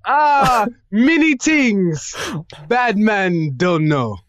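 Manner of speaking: slow, drawn-out fake Jamaican patois; hammed-up Jamaican accent, slow; bad Jamaican accent. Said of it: "Did I do it right?".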